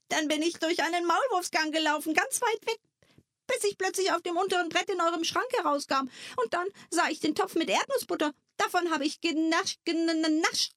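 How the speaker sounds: a small sprite eagerly telling its journey; little creature. Acted. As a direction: Wiplala recounting his tunnel trip, quick and high.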